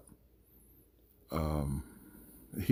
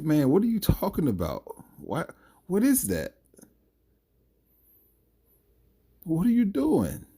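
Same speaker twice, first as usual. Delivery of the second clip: incredulous; 11 semitones higher, 4 dB louder than usual. Real, not acted. Incredulous rant at Joshua.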